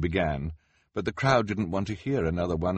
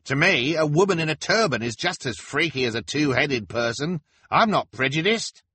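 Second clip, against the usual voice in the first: high, loud heckler voice; brash, pitched-up man in a crowd; pimpled heckler; 11 semitones higher, 7 dB louder than usual. Acted.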